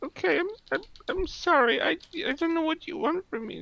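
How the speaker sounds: high, tearful fake sob voice; mock crying. Retelling the agent faking tears at the caller, high tearful voice. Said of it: "And she started crying like".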